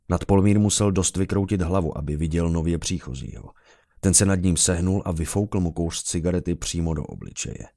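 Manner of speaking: plain reading voice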